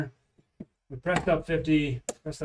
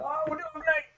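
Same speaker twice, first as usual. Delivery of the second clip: much higher, mocking cheer on "Oh, we're doing great."; airy mock brag, far higher; mock boast; 12 semitones higher, 4 dB quieter than usual. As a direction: imitate everybody jinxing it with a smug "Oh, we're doing great.". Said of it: "I think everybody does that".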